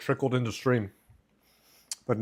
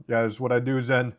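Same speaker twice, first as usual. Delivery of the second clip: ordinary talk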